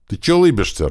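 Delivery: annoyed sharp question; irritated. A narrator's reading. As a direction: snap at the grinning driver. Angry question to grinning driver.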